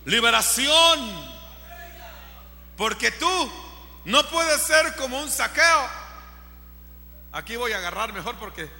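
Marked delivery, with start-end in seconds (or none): 0.0-1.3 loud, emphatic preaching cry; forceful proclamation. Real, not acted. Preacher declaring it is liberation, proclaiming loudly. 2.8-5.9 raised, loud preaching voice; forceful exhortation. Real, not acted. Preacher warning youth about being a Zaqueo, exhorting loudly.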